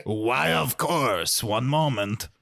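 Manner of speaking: slow, obliging put-on butler voice; Pierre the butler. Butler agrees to transfer the caller, mock-formal and slow. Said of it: "Oh, hey, Pierre".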